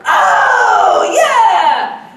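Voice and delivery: high, loud sarcastic cheer; mocking shout back. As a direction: overdo the cheer to mock the other dad. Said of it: "So I started responding kind of like this".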